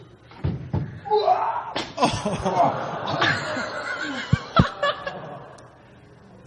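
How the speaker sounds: loud, high burst of real laughter; big laugh. Real, not acted. Speaker cracking up at the clip he played, loud laugh. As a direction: break up laughing at the video.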